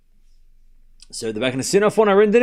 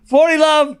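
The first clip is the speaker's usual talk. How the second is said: excited score call; 10 semitones higher, 5 dB louder, slower than usual. Real, not acted.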